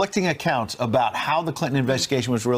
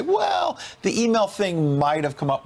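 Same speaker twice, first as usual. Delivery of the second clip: high, drawn-out "Well", then slowing; mock hedging; 8 semitones higher, slower than usual. Pundit guessing what the interviewee will say, mock hedging. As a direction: imitate someone reluctantly conceding. Voices what Lynch might say.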